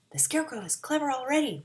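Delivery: usual speaking voice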